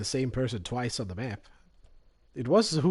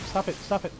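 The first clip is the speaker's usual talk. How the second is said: panicked shouting; 7 semitones higher than usual. Real, not acted. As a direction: yell it at the attacker in panic. Panicked gamer under attack.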